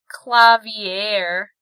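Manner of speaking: clavier said the German way, klah-VEER; German pronunciation. Retold or imitated. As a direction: clavier with German vowels, stress on the end. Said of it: "You're pronouncing Clavier's name wrong. It's pronounced".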